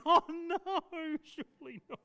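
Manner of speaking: can't believe it, trailing off; disbelieving mother. Acted. Mother voiced in retold story.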